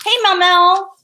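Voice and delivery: loud high call across the room; sing-song call to Mel Mel. Real, not acted. Calling out to someone.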